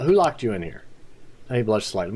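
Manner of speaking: usual speaking voice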